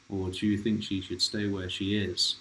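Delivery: ordinary delivery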